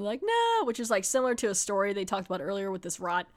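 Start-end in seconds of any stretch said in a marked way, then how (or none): 0.2-0.6 a high, drawn-out No; hammed-up horrified cry; mock scream, pitched way up. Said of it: "he's screaming".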